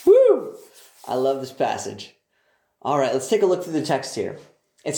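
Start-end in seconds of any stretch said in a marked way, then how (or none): 0.1-0.6 high, loud whoop of delight; excited preacher's whoop; joyful whoop. Real, not acted. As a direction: cry out with glee.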